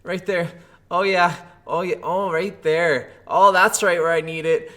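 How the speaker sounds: lightly hammed pleasure; mock moaning. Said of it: "and it's like".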